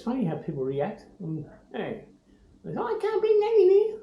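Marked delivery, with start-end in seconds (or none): 2.7-4.0 very high, put-on shop clerk voice; retelling a shop clerk objecting to the parrot, very high mock voice; mimicking a shopkeeper. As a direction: play the startled clerk mockingly.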